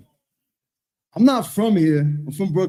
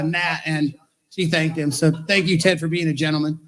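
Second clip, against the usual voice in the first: ordinary delivery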